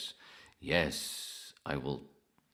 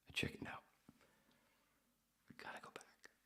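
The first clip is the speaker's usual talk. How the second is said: hushed whisper; 13 dB quieter, faster than usual. Real, not acted. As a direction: whisper it like a confession. Whispered aside about chickening out.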